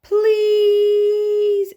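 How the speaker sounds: high, loud pleading please; hopeful plea. A narrator's reading. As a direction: beg hopefully.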